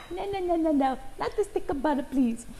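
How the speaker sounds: high, mock-horrified pleading; pleading cook. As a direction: beg the student to stop with the butter.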